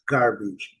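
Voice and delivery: American "garbage" with R sounded in GAR; low, falling American "garbage" with audible R; North American English. Shown as a demonstration. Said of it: "nos Estados Unidos ou Canadá".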